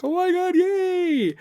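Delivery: very high, thrilled squeal; kid squeal. Acted. A child cheering for dad, very high squeal. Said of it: "And they're like".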